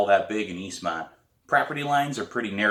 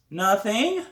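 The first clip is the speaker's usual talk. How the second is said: put-on young girl; 12 semitones higher than usual. Girl answering defensively.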